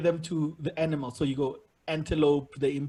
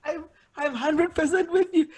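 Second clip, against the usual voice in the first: ordinary talk, nothing marked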